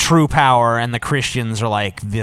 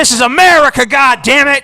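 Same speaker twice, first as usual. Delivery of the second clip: higher shouted yell; mock patriotic yell; 11 semitones higher, 6 dB louder than usual. Mocking patriotic outburst.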